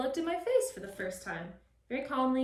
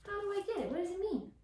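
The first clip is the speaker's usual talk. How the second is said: puppy's thoughts; 8 semitones higher, 3 dB quieter than usual. Retold or imitated. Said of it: "He's like".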